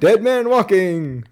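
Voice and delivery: high, drawn-out mock proclamation; Fletcher. Answering the other guy's greeting, imitated taunt. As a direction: stretch it out loud and high. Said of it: "that's when he says".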